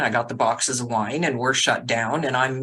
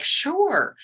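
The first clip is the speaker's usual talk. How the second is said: breezy agreement; 13 semitones higher than usual. Retold or imitated. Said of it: "I'm like".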